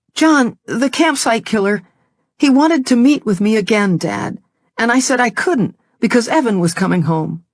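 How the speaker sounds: pleading, a little breathless; upset daughter. Acted. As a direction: daughter defending herself to Dad. Daughter explaining to angry dad.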